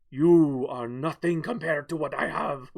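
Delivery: slow villain boast into evil laughter; hammed-up supervillain gloating. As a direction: gloat slowly, then cackle. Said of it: "Evil laughter".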